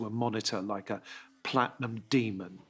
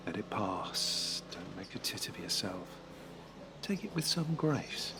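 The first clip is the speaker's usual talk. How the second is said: quiet inner voice; 3 semitones lower, 7 dB quieter than usual. Acted. George's inner self-talk.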